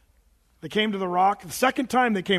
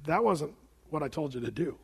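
low, soft reproof; understated letdown, voice dropped; quietly disappointed Lord; 5 semitones lower, 7 dB quieter than usual. Acted. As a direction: rebuke him gently and low. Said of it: "Lord says".

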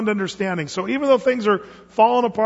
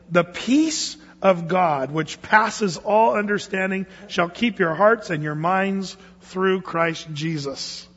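slow, deliberate scripture read; slower than usual. Shown as a demonstration. Pastor reads the promise slowly to the congregation, unhurried. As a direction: let each word land slowly. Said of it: "I'm gonna read it slowly".

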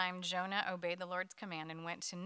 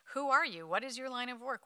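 higher, louder rapid questioning, lightly acted; demanding questions; 5 semitones higher, 5 dB louder than usual. A narrator's reading. Sailors interrogating Jonah in the storm, demanding. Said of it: "they demanded".

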